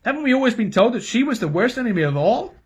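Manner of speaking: high, pleading protest; alarmed king. A narrator's reading. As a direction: raise your voice in disbelief.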